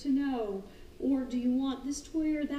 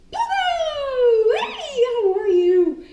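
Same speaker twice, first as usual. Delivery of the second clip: high loud excited call, Pogo; calling the dog; 12 semitones higher, 14 dB louder, much slower than usual. Retold or imitated. Trainer greeting jumping dog Pogo, loud high call. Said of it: "I'm like".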